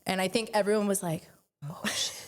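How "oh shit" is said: whispered, imitated aside; hushed dismay. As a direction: mimic an awed whisper under your breath. Retelling everyone's hushed reaction outside room.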